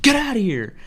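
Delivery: high, loud order; mock furious shout; angry stranger yelling. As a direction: imitate a man chasing you off. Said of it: "You're fucking yelling at me first".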